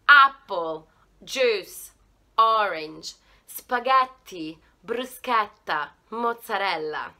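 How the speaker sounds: loud, clear model words; repeat-after-me drill. Shown as a demonstration. Teacher drills viewers on every word, loud. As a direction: say each word for them to copy. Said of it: "try it together one more time".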